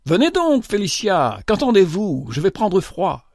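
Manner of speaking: high, imperious call; cri impérieux. A narrator's reading. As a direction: summon her impatiently, pitched high. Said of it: "cria une voix impérieuse". (appel impérieux, voix haut perchée)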